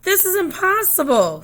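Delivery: high, loud wail of frustration; a kid's exasperated high-pitched complaint; frustrated outburst. Acted. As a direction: cry out that it can't be done. Sophia frustrated after falling.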